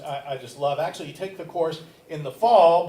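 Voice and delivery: ordinary talk, nothing marked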